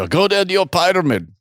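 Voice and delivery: bad German accent. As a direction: imitate a German pronouncing "pyramid" his way. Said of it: "in my worst German accent".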